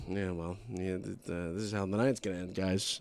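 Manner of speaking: low, soft resigned murmur; resigned bystanders. Retold or imitated. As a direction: accept doom under your breath. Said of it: "are sitting there going".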